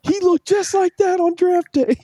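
high, strained ranting father; angry Jets dad. Retold or imitated. Jets fan dad warning his son about Brady, high strained rant. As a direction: crank the pitch up like a fed-up dad lecturing. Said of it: "And he goes".

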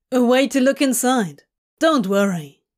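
lightly voiced brisk, confident demon; voiced demon character. Crowley brushing off Hob's doubts, brisk demon voice.

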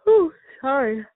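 yawning while talking; words stretched by a yawn. Real, not acted. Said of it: "that yawn".

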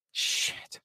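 barely voiced muttered curse; hushed cursing. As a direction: swear under your breath. Dreading doorbell on game day.

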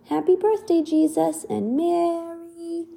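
creatures' greeting. Acted.

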